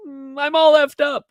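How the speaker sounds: put-on a girl cracking as the tears come; about to cry. Girl breaks down before crying.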